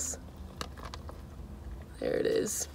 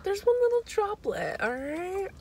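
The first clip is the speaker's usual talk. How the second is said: high-pitched defensive; 13 semitones higher, slower than usual. Real, not acted. Defending a spilled droplet.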